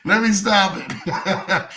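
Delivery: cracking up, trying to stop; laughing at his own joke. Real, not acted.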